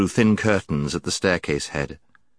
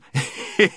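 knight's laugh; 7 semitones higher than usual. A narrator's reading. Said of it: "He laughed very loudly".

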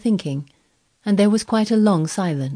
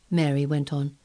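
ordinary delivery, nothing marked

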